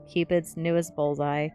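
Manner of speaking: plain reading voice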